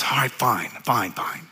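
low, soft, resigned concession; imitated grudging surrender. Old vet giving up on talking his friend out of it, resigned. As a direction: drop the voice and give in.

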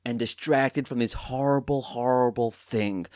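slow, hushed fake-solemn TV voice; mock sincere announcer. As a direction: parody a syrupy sports host. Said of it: "they always start off now".

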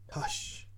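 unvoiced rattling shush; rattling hush. A narrator's reading. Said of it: "rattle the authors".

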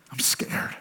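soft unvoiced whisper, frightened; whispered confession. Praying like a child to a father, a scared whisper.

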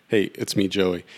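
ordinary talk, nothing marked

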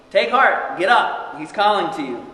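loud, high urging call; urgent shouted encouragement, read aloud. A narrator's reading. Crowd calling to the blind man.

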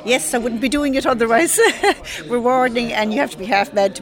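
laughing it off; bright laugh through the words. Real, not acted. Laughing agreement.

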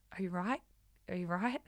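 soft, quiet check-in; gentle concern. Retold or imitated. Wife quietly asking her emotional husband if he's okay, soft and gentle. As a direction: ask it softly, like leaning in close.

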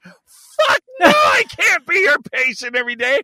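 high, loud yelp; hammed-up hysterical protest; mock outraged refusal. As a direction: cry out the refusal in mock horror. Acted outraged refusal to nurse.

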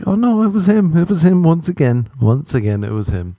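high, sure woman's voice falling away at the end; mock woman voice. Women after a date blaming him, in a high mock voice. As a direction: play a woman laying the blame on him.

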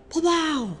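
a sharp, high burst; sudden outburst. Retold or imitated. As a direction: let it all explode at once. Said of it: "I'll just be like".

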